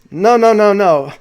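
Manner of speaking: loud, high run of protest; emphatic objection. Retold or imitated. Retelling Danny cutting in on Lou, loud imitated objection. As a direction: cut in forcefully, acting out an objector.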